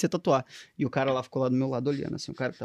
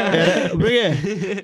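laughing; 5 dB louder, much slower than usual. Real, not acted. Laughing reply to a joke.

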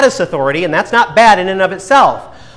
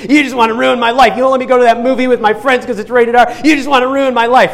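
put-on higher-pitched child complaining to parents; whining little kid; 6 semitones higher, faster than usual. Little kid complaining to parents about a forbidden movie, whining. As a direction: protest like an aggrieved kid, pitched up. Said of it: "this is like a little kid saying".

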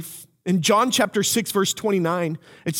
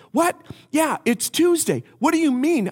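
confused friend; 9 semitones higher than usual. Retold or imitated. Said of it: "He goes".

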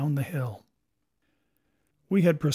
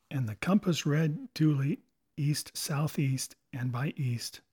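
ordinary delivery

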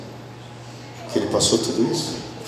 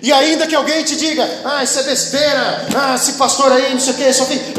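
high, ringing pulpit voice; fervent preaching; 13 semitones higher than usual. Real, not acted. Preaches at full force.

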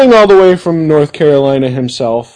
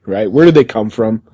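normal talking voice

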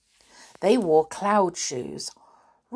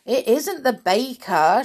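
raised, louder shouted correction; shouting; 5 semitones higher, 5 dB louder than usual. A narrator's reading. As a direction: shout back up to correct them. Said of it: "shouted Binky".

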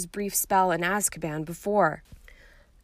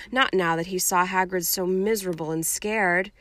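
plain reading voice

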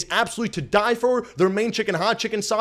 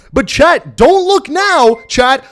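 higher, louder, slow dramatic tease; hyped tease; 5 semitones higher, 7 dB louder, much slower than usual. Acted. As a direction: build suspense for the chat. Hyping standings reveal to chat.

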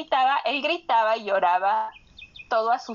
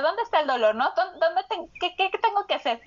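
higher, quick, stammered questions; cerebro confundido; 7 semitones higher, faster than usual. Retold or imitated. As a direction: act out a mildly frantic, searching brain. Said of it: "tu cerebro está con esto de". (voz de confusión angustiada, aguda y atropellada)

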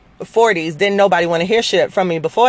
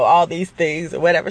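ordinary talk

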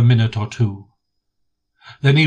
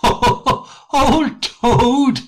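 high, laughing scoff; laughing heartily; 12 semitones higher than usual. A narrator's reading. Rat scoffing at brave Toad, laughing heartily. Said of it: "laughing heartily".

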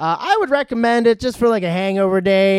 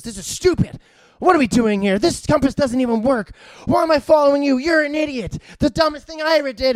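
higher-pitched exasperated rant, imitating a movie hero; mock temper tantrum; 7 semitones higher than usual. Retelling The Rock berating his jungle companion, mock tantrum. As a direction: play a tantrum without really shouting. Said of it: "The Rock has a temper tantrum".

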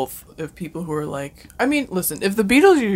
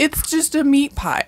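ordinary talk

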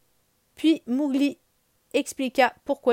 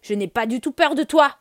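brave face; 4 semitones higher, 7 dB louder than usual. Acted. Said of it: "Il prend son courage à deux mains".

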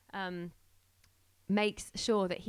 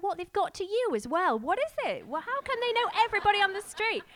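higher, louder incredulous questions; amazed driver; 12 semitones higher, 8 dB louder than usual. Retold or imitated. The driver marvelling that they know everybody, high and incredulous. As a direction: throw up your hands in disbelief, higher.

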